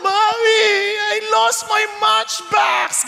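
high, slow mock wail of a small child; preacher voicing a sobbing child over a lost matchbox, high wailing; crying child. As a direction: whine it like a kid who lost his toy. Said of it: "iyak ng iyak".

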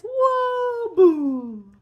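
high whoa swooping down into a loud boom; tumbling whoa. Shown as a demonstration. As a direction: act out a big fall, loud. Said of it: "You can go like this".

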